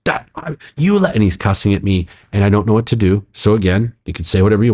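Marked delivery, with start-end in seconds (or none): 0.0-1.2 mock freak-out, high and halting; retold freak-out. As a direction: imitate someone freaking out in broken bursts. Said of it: "he starts freaking out".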